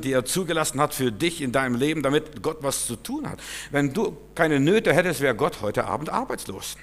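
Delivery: normal talking voice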